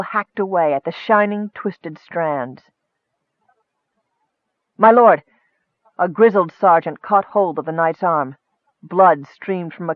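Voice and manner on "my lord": loud, raised-pitch hail; forceful battlefield cry; urgent call. Acted. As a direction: call out for the commander's attention. Sergeant calls to knight in battle.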